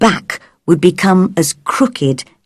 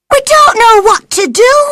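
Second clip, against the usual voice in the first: Martha crying out; 4 dB louder, much faster than usual. A narrator's reading. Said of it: "she cried".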